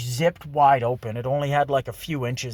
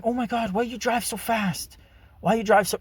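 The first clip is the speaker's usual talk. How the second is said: high, mock-alarmed passenger voice; imitating alarmed date; 10 semitones higher than usual. Imitating a passenger complaining about his driving, high mock alarm. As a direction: mimic her fretting, pitched way up.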